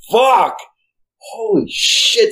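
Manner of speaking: high-pitched, stretched-out retold amazement; amazed exclamation. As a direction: relive the thrill, swooping way up and drawing it out. Reliving amazement after first boot camp.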